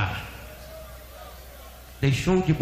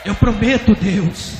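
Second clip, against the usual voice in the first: high, loud promise to God; impassioned vow; 6 semitones higher, 5 dB louder than usual. Retold or imitated. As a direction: pledge it forcefully at raised pitch. Vow repeated at full force. (promessa fervorosa em voz alta)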